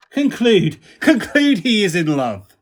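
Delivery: laughing. Acted. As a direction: push the verdict out amid laughter. Friends teasing lovesick Benedick.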